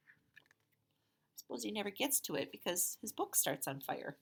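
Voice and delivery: plain reading voice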